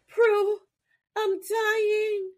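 high, sung, melodramatic wail; hammed-up dying plea. Host voicing Piper begging her sister, hammed-up wail.

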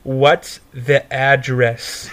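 slow, spelled-out question; imitated impatient clerk. Pizza lady asking the dazed caller, slow deliberate question. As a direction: space out each word deliberately. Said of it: "And she goes".

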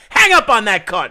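loud, slow and furious at the caller; angry order. Real, not acted. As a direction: bark it out to get rid of him. Angry host cutting off caller.

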